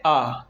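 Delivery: long open "आ" held a little; long aa sound. Shown as a demonstration. Teacher shows pupils the long vowel आ. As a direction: draw out "आ" to show its length. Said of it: "तो इसकी ध्वनि क्या है?".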